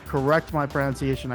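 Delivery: ordinary delivery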